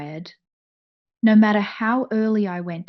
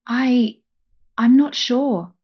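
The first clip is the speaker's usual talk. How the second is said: halting, stumbling start; stammering; 5 semitones higher, 4 dB louder, slower than usual. A narrator's reading. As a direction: stammer it out, unsure. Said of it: "I stammered".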